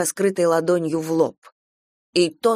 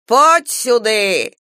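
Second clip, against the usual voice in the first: long-drawn, raised summons; loud roar; 4 semitones higher, 6 dB louder, much slower than usual. A narrator's reading. Old woman ordering the husband over, roaring. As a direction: bellow it, stretching the words. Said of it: "вдруг неожиданно громко и язычно рявкнула".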